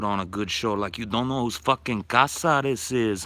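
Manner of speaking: put-on Chicano gangster voice, tough. Mock Chicano gangster voice rant.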